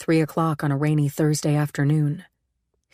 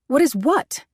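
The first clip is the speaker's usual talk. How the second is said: startled question; 9 semitones higher than usual. A narrator's reading. Taken aback.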